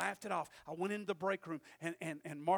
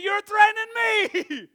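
higher, louder and slow, words drawn out; shouted retort; 10 semitones higher, 14 dB louder, slower than usual. Retold or imitated. Shouting back at the boss, slow and loud. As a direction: hurl it back at him.

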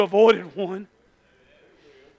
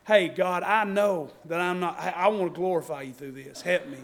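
quiet prayer; 7 semitones lower, 8 dB quieter than usual. Pretend. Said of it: "I'm just like".

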